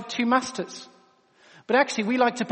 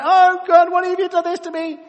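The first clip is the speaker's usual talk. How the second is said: high, loud, exaggerated whining complaint; whiny moan to God; 8 semitones higher, 8 dB louder than usual. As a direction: ham up a self-pitying lament to God. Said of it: "he has a bit of a moan to God".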